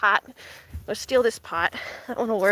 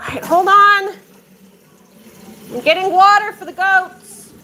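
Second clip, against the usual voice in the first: loud, raised call; 7 semitones higher, 8 dB louder than usual. Real, not acted. Owner busy getting water for the goats, calling out. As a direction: shout it over, higher, to hold someone off.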